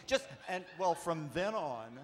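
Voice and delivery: ordinary delivery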